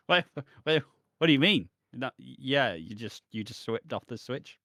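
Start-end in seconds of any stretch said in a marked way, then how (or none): 0.1-1.7 high, loud, fast double take; mock confusion, words tumbling out; baffled. Realizing the fan still runs, loud fast disbelief. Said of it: "I'm like".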